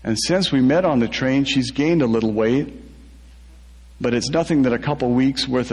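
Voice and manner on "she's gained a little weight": ordinary delivery, nothing marked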